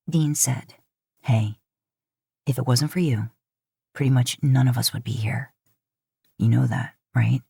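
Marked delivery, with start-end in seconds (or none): 1.2-7.4 lower, steady, reassuring man; low brotherly reassurance; Dean's voice. Acted. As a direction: drop low and calm for Dean. Narrator voices Dean.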